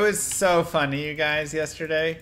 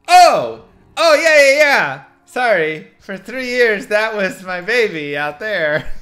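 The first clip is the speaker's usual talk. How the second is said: loud, high burst of sudden realisation; flustered realisation; 7 semitones higher, 11 dB louder than usual. Retold or imitated. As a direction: blurt an imitated, apologetic correction. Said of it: "She's like".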